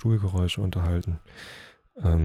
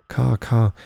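mild call; 4 semitones higher, 8 dB louder than usual. A narrator's reading. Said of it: "Dann aber ertönte es wieder".

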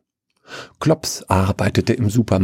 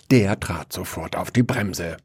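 plain reading voice

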